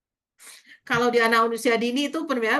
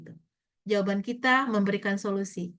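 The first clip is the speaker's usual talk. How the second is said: usual speaking voice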